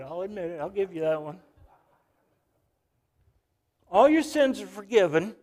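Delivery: normal talking voice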